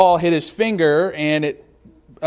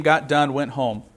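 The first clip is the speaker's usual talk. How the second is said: ordinary delivery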